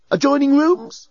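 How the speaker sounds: high, loud bellbot suggestion; questioning robot. A narrator's reading. Bellbot suggests where friends are, questioning. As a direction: offer it as a high-pitched guess.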